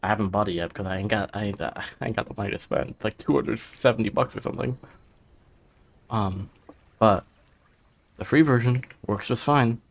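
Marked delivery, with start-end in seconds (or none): none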